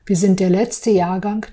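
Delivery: ordinary talk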